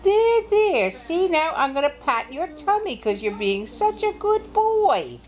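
high, cooing praise as to a puppy; petting and praising. Acted. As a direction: coo over a well-behaved pet. Girl pets the wolf.

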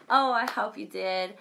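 ordinary delivery

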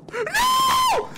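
panicked high scream; panicking gamer. Real, not acted. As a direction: scream in terror as it catches you. Monster chasing him.

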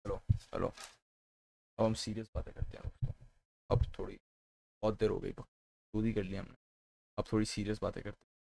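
low, soft, put-on deep voice; deep serious voice. Streamer turning to serious talk with viewers, deep voice. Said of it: "मेरी आवाज़ deep नहीं रहेगी".

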